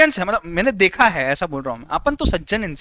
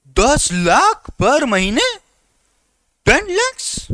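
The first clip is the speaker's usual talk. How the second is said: mock amazement; 5 semitones higher, 4 dB louder, much slower than usual. Boasting manager's salary.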